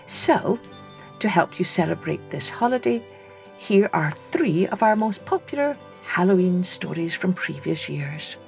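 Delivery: plain reading voice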